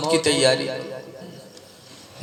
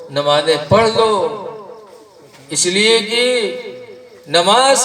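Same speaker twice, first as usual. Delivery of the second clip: high-pitched, passionate sermon voice; fiery preaching; 6 semitones higher than usual. Acted. Preaching rises to full force. (ऊँची आवाज़ में जोशीला प्रवचन)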